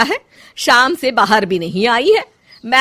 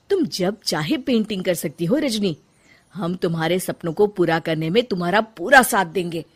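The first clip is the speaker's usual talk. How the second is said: softer and lower, tender promise; reassuring; 4 semitones lower, 7 dB quieter than usual. Acted. Mother-in-law reassuring her bahu about painting, tender. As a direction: comfort her kindly.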